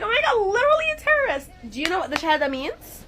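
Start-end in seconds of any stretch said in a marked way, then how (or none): 0.0-1.5 much higher, rushed mock gasp of alarm; mock shocked accuser. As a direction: imitate a panicked, ignorant accuser in a high, quick voice. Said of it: "and you're like".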